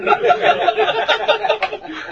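a high burst of real laughter. Real, not acted. Laughing at his own story to the class, a high burst of laughter.

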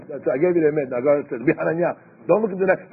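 usual speaking voice

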